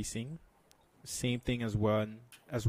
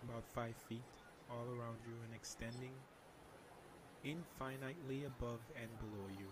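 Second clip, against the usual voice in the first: soft spa whisper; 16 dB quieter than usual. Shown as a demonstration. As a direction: guide them into calm, very softly. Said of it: "can you lead us through a meditation".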